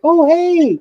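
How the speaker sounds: high, bright mock of a woman saying hi; girl's greeting. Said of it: "she's like".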